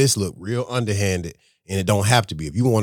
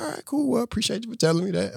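falsely cheerful thanks; 13 semitones higher, 5 dB quieter than usual. Retold or imitated. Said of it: "It's like".

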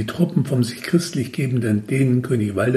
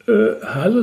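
startled higher exclamation; astonished; 9 semitones higher than usual. A narrator's reading. Narrator astonished by sudden teleport, surprised. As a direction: caught off guard. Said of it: "sagte ich erstaunt".

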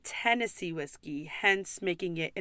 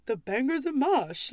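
mock Irish lilt, pitched up; bad Irish accent; 5 semitones higher than usual. Customer ordering food at an Irish pub, hammed-up Irish accent. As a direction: imitate a customer's clumsy fake Irish accent. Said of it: "I can't even do a fucking Irish accent".

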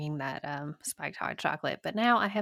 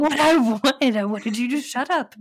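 annoyed; 6 semitones higher, 8 dB louder than usual. Retold or imitated. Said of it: "And I was like".

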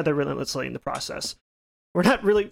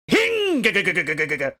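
saw noise. Retold or imitated. Imitating the saw starting.

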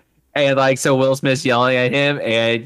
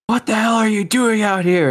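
high, put-on yelling voice; mock angry shout; 10 semitones higher than usual. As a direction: bark it out as the angry cop. Retold movie scene, Will Smith yelling.

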